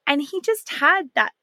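normal talking voice

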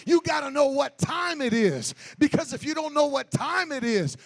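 loud, rising sung preaching; full force preaching. Acted. Preacher shouting holiness at the congregation, loud and sung. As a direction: whoop it up to the congregation.

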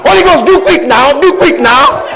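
high, loud pleading with laughs; Jesus pleading. Acted. Jesus pleading with the Holy Ghost to warn her, high loud laughing plea. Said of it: "And then Jesus said".

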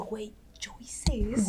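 hushed, unvoiced whisper of amazement; a whispered gasp of wonder at the newborn; stunned whisper. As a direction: breathe it out in disbelief. Stunned thought after birth.

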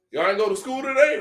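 a dad's voice. Retold or imitated. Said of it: "He like".

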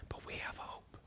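breathy whisper of hope. Acted. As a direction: say it under the breath, reverently. Hushed pronouncement of hope.

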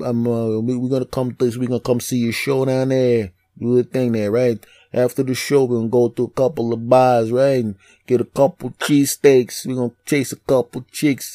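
imitating South Philly buddies; chummy South Philly accent, planning a night out. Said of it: "sounded just like that".

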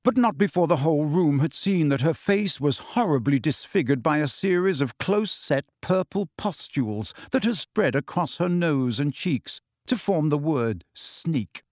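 ordinary delivery, nothing marked

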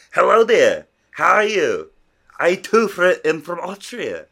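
high, loud hammed-up Austrian greeting; mock Austrian accent. Greeting the Austrian presenter in a hammed-up Austrian accent. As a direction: pretend to be a fellow Austrian meeting him. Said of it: "I would've been like".

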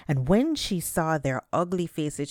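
usual speaking voice

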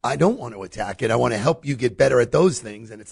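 normal talking voice

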